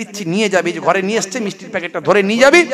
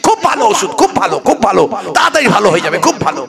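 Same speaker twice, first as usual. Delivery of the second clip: giddy cheerful praise; 3 semitones higher, 6 dB louder than usual. Retold or imitated. Son's clueless cheerful reply.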